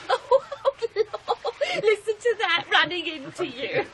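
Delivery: high laughing aside; laughing at herself. Acted. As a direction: chuckle over the accidental pun. Laughing at her own pun.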